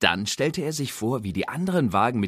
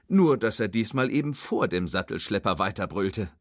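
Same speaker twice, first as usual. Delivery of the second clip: plain reading voice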